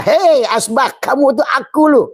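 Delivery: high, loud calling voice of the wood; wood speaking to the ashtray. Acted. The wood calling out to its ashtray, high and loud. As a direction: call out to the ashtray, pitched well up and loud. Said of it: "Maka si asbak kayu".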